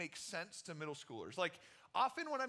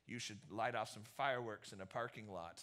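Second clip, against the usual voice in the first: lower, murmured creepy-guy voice; creepy man; 10 semitones lower, 5 dB quieter than usual. Retold or imitated. Theater worker tempting the teens, in a low creepy voice. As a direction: sound like a shady stranger offering trouble.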